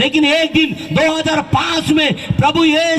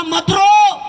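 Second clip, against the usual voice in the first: stern command; 7 semitones higher, much slower than usual. Retold or imitated. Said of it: "उसने कहा".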